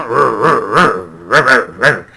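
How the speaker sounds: loud, growling rolled "rrr" sounds like an animal; trilled growl on "rrr", loud. Shown as a demonstration. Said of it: "como si estuvieran gruñendo".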